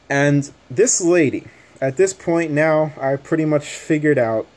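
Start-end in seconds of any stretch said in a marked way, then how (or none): none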